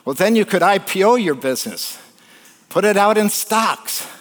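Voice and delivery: high-pitched, energetic sales push; excited pitch. Retold or imitated. Harvard grad pushing a fisherman to go public, excitedly. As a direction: act out an eager dealmaker.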